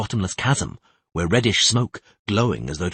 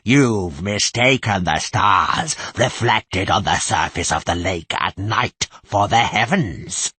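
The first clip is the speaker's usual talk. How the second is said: cold mocking voice; 3 dB louder, slower than usual. The possessing voice mocking Tris, cold and hostile. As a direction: threaten her with cruel scorn. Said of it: "inhuman voice sneered".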